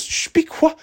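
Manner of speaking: very high mock shushing; imitated mother. Mom hushing son yelling across the yard, high frantic imitation. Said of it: "my mom goes".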